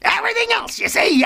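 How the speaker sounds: very high, silly voice; mock emphatic squeal. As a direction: repeat it in a comic falsetto.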